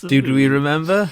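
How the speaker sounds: ribbing a friend's cheesy podcast intro; mock echo. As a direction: throw the line back at him mockingly. Mocking the host's intro line.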